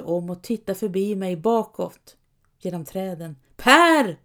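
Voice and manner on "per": high loud call of a name. A narrator's reading. Anders calling Per back, loud. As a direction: shout it through the trees. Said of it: "ropar han".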